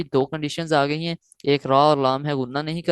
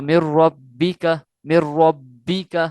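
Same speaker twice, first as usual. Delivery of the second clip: مر ربک very slow, louder on the doubled ب; forceful recitation; 4 dB louder, much slower than usual. Shown as a demonstration. Teacher demonstrating to students: مر ربک slow and emphatic. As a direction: push مر ربک out slowly and forcefully. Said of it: "زور دے کر ظاہر کر کے یا جوش سے پڑھیں گے".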